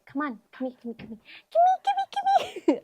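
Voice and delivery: high-pitched sing-song call to a dog. Real, not acted. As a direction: coax the puppy over in a squeaky voice. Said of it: "I'm gonna use my dog voice".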